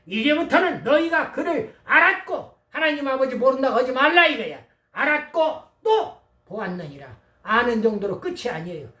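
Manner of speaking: loud, hammering sermon delivery; full-voiced, insistent pulpit proclamation; forceful preaching. Real, not acted. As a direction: drive each point home loudly to the congregation.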